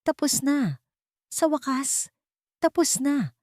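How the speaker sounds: high-pitched relieved Alvaro, exhaling the words; relieved sigh. Acted. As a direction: breathe out finally it's done. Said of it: "malakas na buntong hininga mula kay Alvaro".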